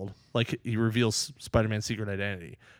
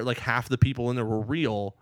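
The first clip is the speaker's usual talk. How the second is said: normal talking voice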